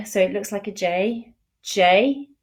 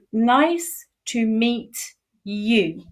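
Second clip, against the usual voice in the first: slow, careful teaching of the phrase; slow demo; much slower than usual. Shown as a demonstration. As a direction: draw out "Nice to meet" slowly for learners.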